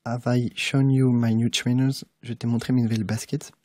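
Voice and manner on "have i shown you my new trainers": slow teacher's example read; slow example sentence. Shown as a demonstration. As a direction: read slowly and clearly. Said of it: "plusieurs exemples".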